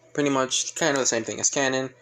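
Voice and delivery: ordinary delivery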